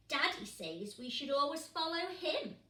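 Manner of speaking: cheeky small penguin. Acted. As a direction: quip it proudly. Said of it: "said Poots".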